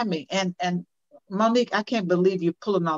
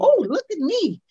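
put-on much higher, fast, eager kid voice; proud little child; 11 semitones higher, 5 dB louder than usual. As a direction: play the child showing off a new find.

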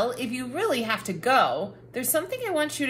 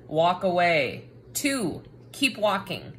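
usual speaking voice

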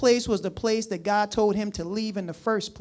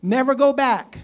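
forceful; 5 semitones higher, 7 dB louder than usual. Real, not acted. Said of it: "preacher punchline refrain".

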